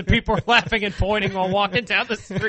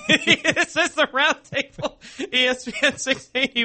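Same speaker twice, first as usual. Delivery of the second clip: laughing; 5 semitones higher than usual. Real, not acted. Station ID while laughing.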